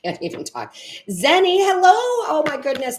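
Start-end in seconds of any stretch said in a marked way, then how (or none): 1.1-3.0 high, loud happy hello; bright burst of welcome to a new subscriber; excited greeting. Real, not acted. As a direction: call out the name with delight.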